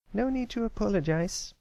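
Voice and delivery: high filly voice, reassuring with a grin; comforting filly. Acted. Apple Bloom comforting Pipsqueak, high reassuring filly voice. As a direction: set his mind at ease. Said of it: "with a comforting grin".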